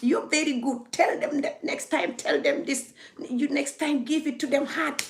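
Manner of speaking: high, laughing, sugary flattery; mock fawning caller. Vlogger mocking a two-faced caller's fawning praise. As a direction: ham up a fake admirer giggling. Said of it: "They'll even call you".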